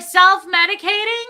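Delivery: high, loud questioning holler; yelling from afar. Retold or imitated. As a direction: imitate a tipsy mom shouting across aisles. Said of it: "so far away from me".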